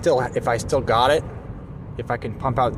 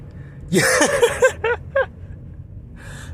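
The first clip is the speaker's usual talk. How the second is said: high breathy laugh. Real, not acted. Laugh after evil whisper.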